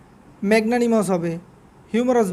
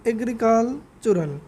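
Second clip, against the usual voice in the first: Agricultural said slowly four times; slow pronunciation drill; slower than usual. Shown as a demonstration. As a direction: slowly repeat agricultural for students. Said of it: "মূলত উচ্চারণ হবে agricultural". (ধীরে ধীরে agricultural বারবার উচ্চারণ)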